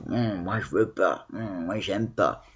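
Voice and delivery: low, soft grumbling refusal; Schtroumpf grognon. Acted. Teacher illustrating "ronchonne" for pupils, grumpy low grumble. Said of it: "comme le schtroumpf grognon".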